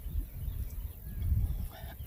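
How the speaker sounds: a soft real clear of the throat; muffled throat clear with a bit of food caught. Real, not acted. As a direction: clear your throat quietly, something stuck. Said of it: "I'm sorry".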